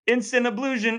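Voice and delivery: high, pompous announcement; mock proclamation. Co-host declaring an instant ablution, high mock fanfare. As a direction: proclaim it like a ceremony.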